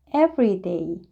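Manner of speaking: ordinary delivery, nothing marked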